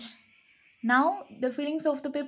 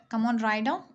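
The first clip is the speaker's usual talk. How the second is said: usual speaking voice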